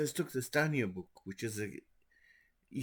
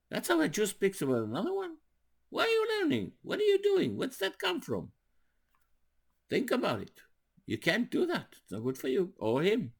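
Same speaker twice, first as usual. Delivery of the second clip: put-on much higher, pressing rebuke of a boy; stern rebuke; 10 semitones higher, 4 dB louder than usual. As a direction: scold him like a disappointed teacher. Retold reprimand of a fighting boy.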